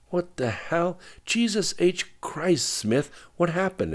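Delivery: shocked. A narrator's reading. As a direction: gasp it out in alarm. Wife hears Katie was shot.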